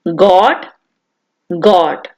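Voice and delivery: normal talking voice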